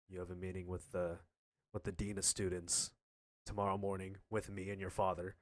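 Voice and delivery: lightly voiced stern mom, low and level; low, subdued delivery of an ominous message; a hint of a grave mother; mom breaking bad news. Retelling mom's grave announcement.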